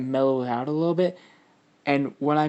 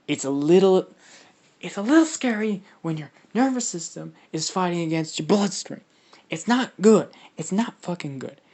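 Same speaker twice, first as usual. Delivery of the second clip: higher-pitched, animated exclamation; animated alarm; 6 semitones higher, 5 dB louder than usual. Real, not acted. Commenting on the scary inner fight.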